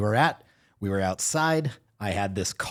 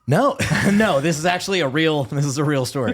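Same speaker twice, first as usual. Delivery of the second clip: laughing protest; 5 semitones higher, 6 dB louder than usual. Real, not acted.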